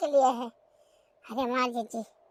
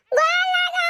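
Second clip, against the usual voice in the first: high, piercing shout for help; calling for help; 12 semitones higher, slower than usual. Acted. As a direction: yell out to be heard far away. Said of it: "और जोरे चिल्लाओ बच्ची".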